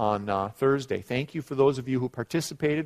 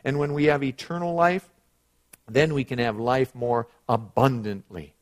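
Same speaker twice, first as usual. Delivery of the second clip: loud, raised preacher's delivery; emphatic preaching; 4 semitones higher, 9 dB louder than usual. Acted. Preacher telling the congregation of eternal life, emphatic preaching. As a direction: drive the words out to the congregation.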